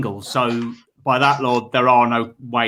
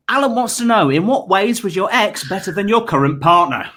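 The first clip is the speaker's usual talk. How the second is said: host question read; 6 semitones higher, 4 dB louder than usual. Acted. Reading viewer question.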